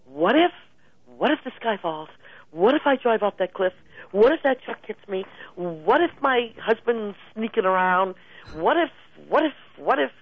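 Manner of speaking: the worrying mind, a touch of mock alarm; anxious worry voice. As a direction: spiral into mock panic. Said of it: "worry words go".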